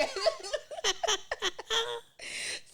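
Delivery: high, loud, almost crying laugh. Real, not acted. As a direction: laugh till it sounds like crying. Cracks up after acting it out.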